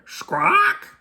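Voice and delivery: very high, shrill squawk; parrot squawk. Acted. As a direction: let out a startled bird squawk. Parrot nearly falls off.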